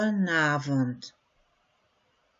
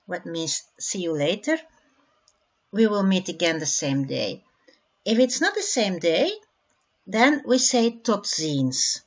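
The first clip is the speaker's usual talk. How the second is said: usual speaking voice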